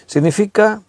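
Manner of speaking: ordinary delivery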